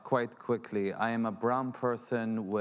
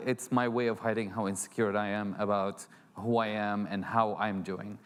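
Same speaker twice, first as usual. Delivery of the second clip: ordinary delivery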